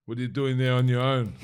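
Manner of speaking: a hint of Arnold's voice, loud across the room; imitating Arnold. Arnold calling him over to his table, lightly voiced. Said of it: "he's like".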